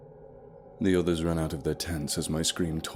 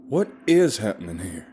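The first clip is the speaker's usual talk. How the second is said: angry yell, louder; 5 semitones higher, 9 dB louder than usual. Acted. Jake demanding answers from the guide, angry yell. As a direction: hurl the question at the guide. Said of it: "yelled Jake".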